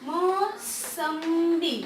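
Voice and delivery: Mosambi drawn out very slowly, syllable by syllable; slow teacher sounding out of mosambi. Shown as a demonstration. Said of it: "अब जैसे मैं अगर यहाँ पे लिख दूँ".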